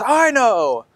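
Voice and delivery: high shout from the crowd; crowd member shouting. Acted. Someone in the crowd shouts, high. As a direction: call it out from the back. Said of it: "in the crowd shouts".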